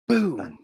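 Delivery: high, falling "boom" sound effect; imitated boom. Commentator imitating a door kicked shut, boom sound. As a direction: voice a mock slam, pitch dropping.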